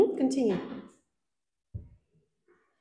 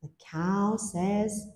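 slow prompting; 4 semitones lower, slower than usual. Shown as a demonstration. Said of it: "teacher drawing out the answer".

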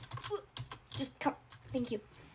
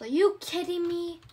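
outraged; 4 semitones higher, 8 dB louder than usual. Acted. Dance canceled outrage.

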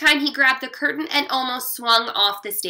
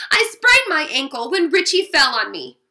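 louder, pained complaint; wailing; 4 semitones higher, 7 dB louder than usual. A narrator's reading. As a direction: keep wailing about the ankle. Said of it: "he wailed".

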